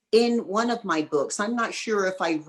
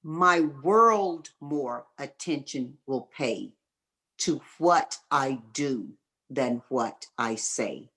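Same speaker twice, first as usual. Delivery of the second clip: slow and deliberate, every word separate, lower at the end; slow dictation pace; 3 semitones lower, slower than usual. Shown as a demonstration. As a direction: recite the maxim slowly so they can write it down. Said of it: "Write this down".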